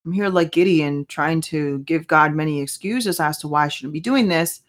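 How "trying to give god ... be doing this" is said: ordinary delivery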